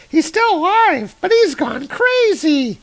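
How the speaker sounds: put-on very high squeaky kid voice. Kids find Baldur talking nonsense, high squeaky kid. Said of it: "the kids are like".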